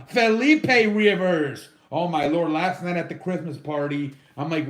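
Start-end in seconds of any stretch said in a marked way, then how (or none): 0.1-1.7 loud, drawn-out mock rendition of the name; uncle's nickname voice. Host quoting his uncle's name for Philip, mock uncle voice. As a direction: imitate an uncle, stretching the name out. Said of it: "as my uncle calls him".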